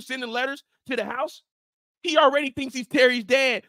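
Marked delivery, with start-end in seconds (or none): none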